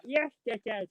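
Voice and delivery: imitated Hull accent. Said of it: "you popped back".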